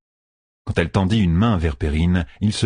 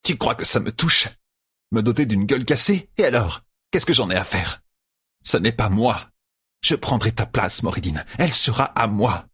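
lightly voiced grumbling villainess, slightly raised pitch; a touch of resentful muttering to herself; 4 semitones higher than usual. A narrator's reading. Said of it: "grommelait la traqueuse". (ronchonnement légèrement joué)

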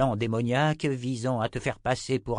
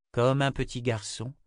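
plain reading voice

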